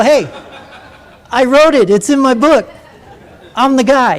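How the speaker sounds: indignant storyteller's retort; insistent claim. Retold or imitated. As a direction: protest loudly that it's yours.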